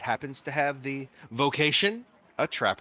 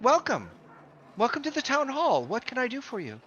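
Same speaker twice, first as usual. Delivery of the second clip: put-on higher, bright old lady welcome; old clerk greets the party at the town hall, higher; old woman greeting; 10 semitones higher than usual. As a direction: sing out a welcome like an elderly clerk. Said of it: "she looks at you guys and says".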